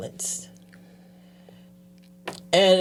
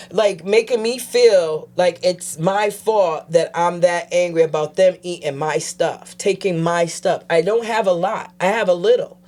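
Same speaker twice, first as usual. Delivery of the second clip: ordinary talk, nothing marked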